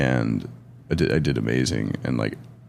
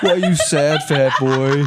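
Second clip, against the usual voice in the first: high, loud, laughing mock taunt; mocking nurse voice; 10 semitones higher, 5 dB louder, slower than usual. Comic mocking psych ward staff, high laughing taunt. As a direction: ham up a rude nurse while laughing. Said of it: "What are they supposed to be like?".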